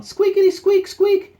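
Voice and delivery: very high, loud toy squeaks; squeaky toy imitation. Dog chewing its toy, high piercing squeaks.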